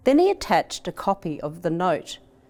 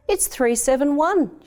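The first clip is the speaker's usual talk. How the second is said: excited higher yell; 8 semitones higher than usual. Acted. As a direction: call it out in relief and excitement. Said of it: "yelled the".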